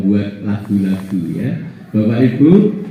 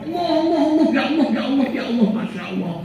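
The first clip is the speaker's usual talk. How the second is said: high, breathless calls of Allah fading softer; awed cry; 14 semitones higher, 5 dB quieter, much faster than usual. Acted. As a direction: gasp out Allah in amazement. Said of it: "salah satunya teriak keras sekali". (seruan takjub yang melemah)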